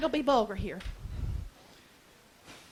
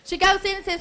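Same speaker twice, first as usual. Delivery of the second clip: usual speaking voice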